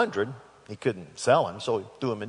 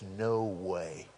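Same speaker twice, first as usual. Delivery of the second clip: cold refusal; 5 semitones lower, 9 dB quieter than usual. Retold or imitated. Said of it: "with that cold, steely glare".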